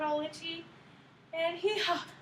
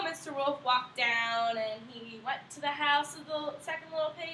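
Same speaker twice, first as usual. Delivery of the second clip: plain reading voice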